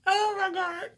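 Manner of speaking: high, loud choked oh my God; real emotion breaking through; emotional gasp at the test result; overwhelmed. Real, not acted. Reacting to pregnancy test.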